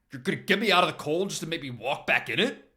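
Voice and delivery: mock the offer; scoffing. Max scoffing at Paul's offer of shelter. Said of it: "Max scoffs".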